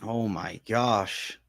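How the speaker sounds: soft, slow, stunned. Real, not acted. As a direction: barely get it out in disbelief. Disbelief at cancelled fight.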